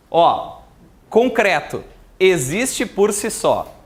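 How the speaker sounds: slow, spaced dictation for notes. Shown as a demonstration. Teacher dictating to the class, slow and even. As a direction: read it out slowly so they can write. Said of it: "Vamos anotar".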